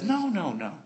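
soft, low, unhurried reassurance; comforting. Retold or imitated. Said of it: "Joseph comforts them and says".

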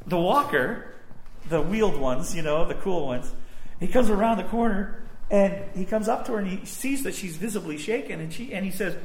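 ordinary talk

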